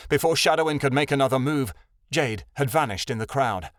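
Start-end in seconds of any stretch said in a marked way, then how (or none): none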